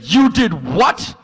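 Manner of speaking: high, slow incredulous gasp of a question; mock-appalled partner; shocked disbelief. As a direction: play the horrified partner. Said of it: "And then Haley said".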